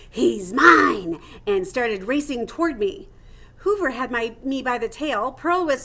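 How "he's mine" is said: loud, hissing threat; hissed. Acted. Cat claiming the hamster as her prey, loud hiss. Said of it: "who hissed".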